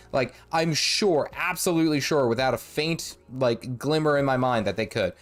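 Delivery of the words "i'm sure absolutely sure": ordinary talk, nothing marked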